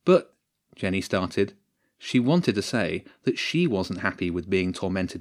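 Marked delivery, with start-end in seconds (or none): 0.0-0.4 high, loud interjection; abrupt objection. Acted. Jenny starts to object to the captain's plan, protesting. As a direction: break in to object, pitched sharply up.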